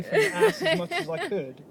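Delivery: squeaky high retelling; high, strained voice as if squirming away; high mock squeal. Telling a friend about the awkward pocket, high mock squeal.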